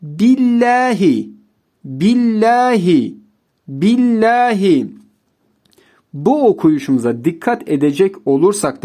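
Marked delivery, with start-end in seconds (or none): 0.0-4.9 billahi with a light, clear lam; light lam in billahi after the esre; thin lam. Shown as a demonstration. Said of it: "lamı ince okurum".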